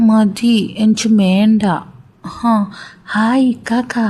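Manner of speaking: lightly voiced slow childish boy; childlike son. Son refusing food from his mother, in a light childlike voice. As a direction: drawl it out like a small child.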